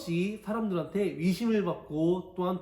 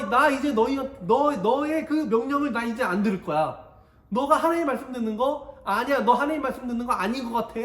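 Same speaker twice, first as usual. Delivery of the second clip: higher, louder, taunting rebel; defiant challenge; 6 semitones higher, 5 dB louder than usual. Acted. Korah confronting Moses over who hears God, taunting and loud. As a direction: jeer at Moses as an equal. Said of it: "그러면서 이야기하는 거예요".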